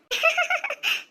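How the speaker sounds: giggle. Acted. Giggling gossip at the wedding.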